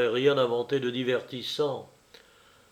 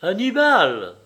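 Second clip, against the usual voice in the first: exclamation; 5 semitones higher, 6 dB louder, slower than usual. A narrator's reading. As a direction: cry out the name, higher and louder. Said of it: "s'écria Jeanne". (s'écrier, appel lancé d'une voix forte)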